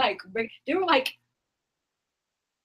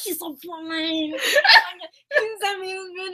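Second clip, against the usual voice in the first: put-on tearful whine, pitched up; mock crying; 6 semitones higher than usual. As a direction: wail it as if overcome, half laughing. Gushing over the handsome friend.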